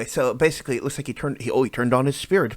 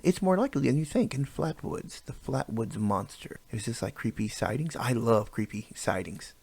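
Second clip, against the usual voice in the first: usual speaking voice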